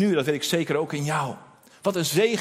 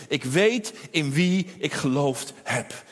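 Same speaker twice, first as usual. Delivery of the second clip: slow, weighty "ik weet in wie ik geloofd heb", "weet" leaned on; deliberate drawn-out "weet"; slow solemn creed; 3 semitones lower, slower than usual. Acted. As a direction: lay each word down slowly like a vow. Preacher repeats Paul's creed.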